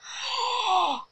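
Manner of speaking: high breathy gasp; delighted gasp. Real, not acted. Seeing the soap's design, a delighted gasp. Admiring the soap.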